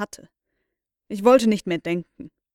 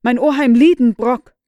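loud, higher, every syllable clear; clear loud call; 4 semitones higher, 11 dB louder than usual. A narrator's reading. Boy calling to his uncle through the wall, loud and clear. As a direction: call out as clearly as you can. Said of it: "sprach so deutlich wie möglich".

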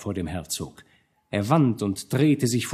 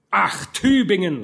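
louder, a bitter cry; terrible voice; 7 semitones higher, 4 dB louder than usual. A narrator's reading. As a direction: cry out the name in anguished rage. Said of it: "rief der Herzog mit schrecklicher Stimme".

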